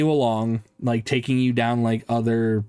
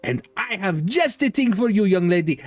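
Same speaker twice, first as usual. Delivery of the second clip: high, broken-English shopkeeper voice; eccentric toymaker; 9 semitones higher than usual. Acted. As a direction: greet the customer with a high, odd accent. Shopkeeper answers the player.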